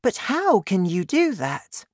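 put-on high child's voice, questioning; puzzled girl. A narrator's reading. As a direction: ask high and curiously, as a girl. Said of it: "Sophie asked".

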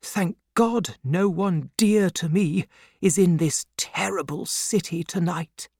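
high, hushed worry; anxious relief. A narrator's reading. Banker alone in a dangerous city, anxiously relieved. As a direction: murmur an anxious thanks to yourself.

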